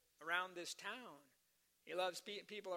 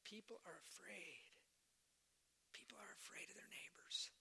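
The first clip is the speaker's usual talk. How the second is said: whisper; 11 dB quieter than usual. Acted. Preacher's confiding realization.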